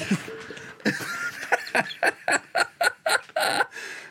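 breathless, wheezing laughter; high squealing laugh. Real, not acted. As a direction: laugh until you squeak. Laughing at the strong drink.

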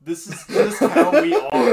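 cracking up mid-sentence; laughing. Real, not acted. Joking they're sober, laughing.

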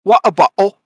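high, loud mock Cockney; Cockney British accent. Co-host adding his other British party piece, loud Cockney accent. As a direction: punch it out as a hammed-up Cockney catchphrase. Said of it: "It's got the Cockney".

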